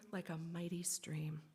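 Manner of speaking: soft whispered closing line. A narrator's reading. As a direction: let the verse land in a whisper. Storyteller's hushed closing line.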